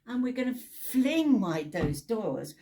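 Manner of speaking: slow, loud and rousing. Real, not acted. Preacher inviting the town in, slow and rousing. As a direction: lift the crowd with it. Preacher building to welcoming climax.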